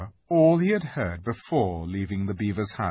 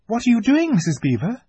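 high, raised, startled question; exclaiming; 13 semitones higher, 6 dB louder than usual. A narrator's reading. Susan questions Missus Beaver's packing, exclaiming. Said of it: "exclaimed Susan".

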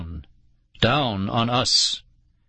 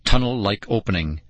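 usual speaking voice

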